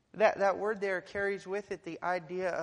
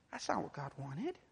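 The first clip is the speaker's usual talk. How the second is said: normal talking voice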